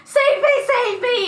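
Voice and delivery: high, loud mock cry for help; mock screaming. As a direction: ham up a panicked plea. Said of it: "keeps screaming".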